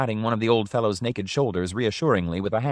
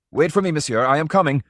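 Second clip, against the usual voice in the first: "I am coming" called out higher and louder; eager call; 7 semitones higher, 6 dB louder than usual. Acted. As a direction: shout after him, desperate not to be left. Old man rushing after patron.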